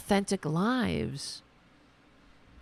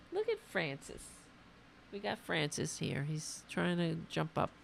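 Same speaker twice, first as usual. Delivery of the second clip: much softer, turned away from the mic; aside to the dog; 12 dB quieter than usual. Real, not acted. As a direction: murmur to the dog beside you. Turns aside to his dog.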